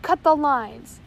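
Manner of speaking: big loud bellow. A narrator's reading. First mate ordering his crew, full bellow. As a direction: yell it with full force. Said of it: "he bellowed".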